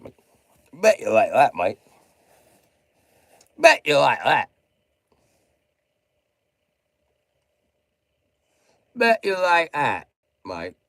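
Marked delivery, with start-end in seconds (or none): 0.7-1.8 much louder, Bet punched hard; loud taunt. Real, not acted. 3.6-10.1 louder and higher, jeering; loud mocking taunt. Real, not acted. Repeated taunt at Mike.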